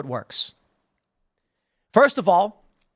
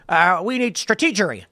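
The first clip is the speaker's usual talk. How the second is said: Bush impression. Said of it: "S N L made fun of him, the famous line".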